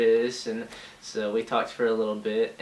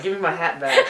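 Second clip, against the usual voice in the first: retold protest; 7 semitones higher, 9 dB louder than usual. Retelling his protest over the taken hat.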